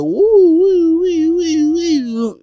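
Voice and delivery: squeaky falsetto woo noises; mock high woo woo. Mocking the coworker with high falsetto woos. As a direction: tease him with cartoon-high woos.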